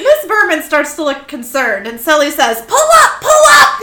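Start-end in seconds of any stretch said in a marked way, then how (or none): 2.7-3.8 very high, loud warning cry; hammed-up frantic squawk; alarmed shout. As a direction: yell it like an emergency alarm. Sully shouts alarm in story.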